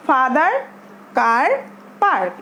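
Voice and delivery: "father", "car", "park" with the "ah" held long; drawn-out "ah". Shown as a demonstration. Teacher showing how to read, "father", "car", "park" with drawn-out "ah". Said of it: "টেনে পড়তে হবে".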